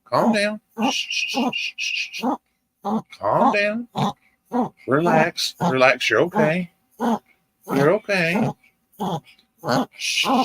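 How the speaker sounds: high, cooing pet-talk with hushing; soothing an excited dog. Real, not acted. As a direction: coax a little dog to settle down. Soothing an over-excited pet chihuahua.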